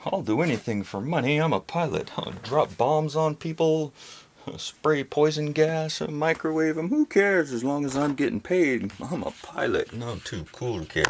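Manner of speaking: high, loud, brash sneering pilot voice; mock pilot. Parodying pilots to viewers, loud high sneering voice. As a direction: ham up a greedy, boastful pilot.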